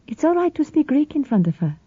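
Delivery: a calm, lightly voiced sister; Sophia's voice.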